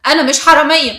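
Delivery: loud, sharp denial. A narrator's reading. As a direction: firmly throw off the accusation. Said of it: "إسراء بحدة".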